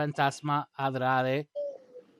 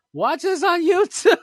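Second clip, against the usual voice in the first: laughing squeal; 9 dB louder than usual. Pretend. Imagined crowd sign, laughing.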